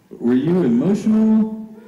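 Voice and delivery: a hint of a high, concerned lady; lightly voiced curious fan; lady's voice. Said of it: "And she said".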